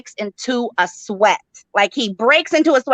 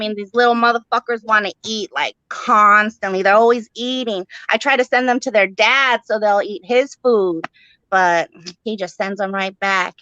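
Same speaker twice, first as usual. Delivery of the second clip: usual speaking voice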